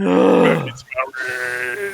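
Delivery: slow ogre drawl. Acted. Player mimics ogre drawl.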